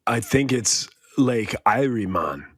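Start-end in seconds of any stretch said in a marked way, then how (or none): 1.2-2.0 "Erie" said as EYE-ree; eye-ree pronunciation. Shown as a demonstration. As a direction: say "Lake Erie" with a long I. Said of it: "that's what I've been calling it".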